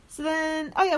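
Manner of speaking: normal talking voice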